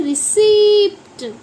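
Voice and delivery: रिसिप्ट stressed as ri-SEEPT, p sounded; emphatic ri-SEEPT for रिसिप्ट; demonstrating pronunciation. Shown as a demonstration. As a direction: model रिसिप्ट with a drawn second syllable. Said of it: "इसकी pronunciation होती है".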